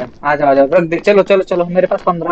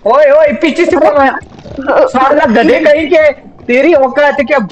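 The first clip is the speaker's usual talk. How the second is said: angry yelling; 7 semitones higher than usual. Real, not acted. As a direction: yell at the mob that hit you from behind. Mob hits him from behind in game.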